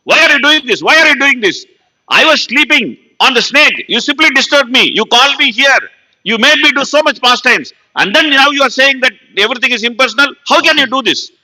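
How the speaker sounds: high, loud, acted angry rebuke; angry scolding. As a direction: scold him in outrage, pitched high. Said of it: "very angry".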